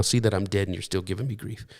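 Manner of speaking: soft mock-scolding mother; a quiet, teasing nag from mother; imitating mother. Pastor recalls his mother teasing him, soft mock scold. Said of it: "I can hear my mother now".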